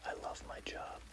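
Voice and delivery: ordinary delivery, nothing marked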